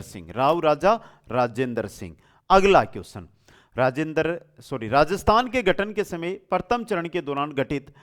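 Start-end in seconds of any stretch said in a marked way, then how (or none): none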